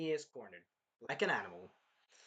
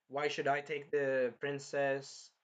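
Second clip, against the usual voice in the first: usual speaking voice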